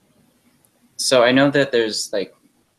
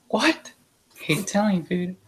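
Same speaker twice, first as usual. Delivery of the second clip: incredulous; 9 semitones higher than usual. Retold or imitated. Boyfriend reacts in disbelief.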